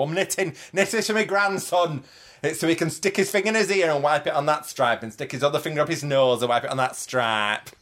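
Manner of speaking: high-pitched Lancashire tea lady; Lancashire accent. Acted. Street-theatre tea lady explains her knitting, high Lancashire accent. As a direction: play a Lancashire granny character, pitched well up. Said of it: "She had a Lancashire accent".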